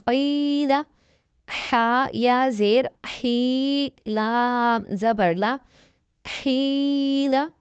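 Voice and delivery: slow, deliberate letter-by-letter recitation, each syllable set apart; slow tajweed spelling drill. Shown as a demonstration. Teacher drilling students in Quran reading, slow syllable recitation. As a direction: spell each letter and vowel slowly for beginners.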